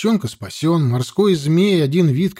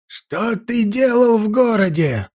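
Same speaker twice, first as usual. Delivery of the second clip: put-on high, sharp shout of an old priest; sharp old man voice; 7 semitones higher than usual. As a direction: snap it out like a stern old man. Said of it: "резкий старческий голос Невида".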